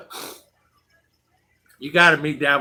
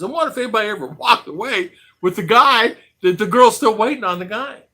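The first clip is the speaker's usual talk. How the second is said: normal talking voice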